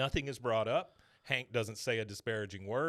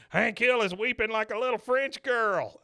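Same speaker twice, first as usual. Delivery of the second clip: higher, louder mocking announcement; mocking Dale; 10 semitones higher, 8 dB louder than usual. Dale mocking Hank to everyone, high and loud. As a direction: jeer at Hank to the whole room. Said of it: "Dale turns to everybody".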